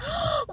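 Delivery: high-pitched delighted gasp; excited gasp. Real, not acted. Reacting to friend's matching sweatshirt, excited gasp.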